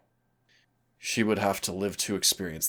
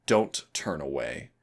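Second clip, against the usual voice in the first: ordinary talk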